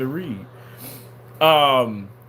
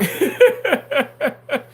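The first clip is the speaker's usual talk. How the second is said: loud, high laugh bursting out; a real laughing fit; high burst of laughter; 11 semitones higher, 5 dB louder than usual. Real, not acted. Laughs at own joke.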